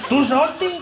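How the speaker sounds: high, loud disbelief; indignant, high-pitched echo; incredulous question. Acted. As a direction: throw it back as a loud, rising question. Osvaldo astonished by the complaint.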